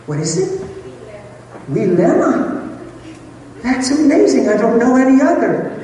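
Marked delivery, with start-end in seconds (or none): 1.7-2.7 higher, rising tentative reading; trying out name. Real, not acted. Reading an unfamiliar name aloud. 3.6-5.7 high, loud, gushing exclamation; delighted and amazed, voice way up. Real, not acted. Delighted by the woman's name.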